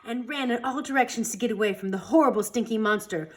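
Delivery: plain reading voice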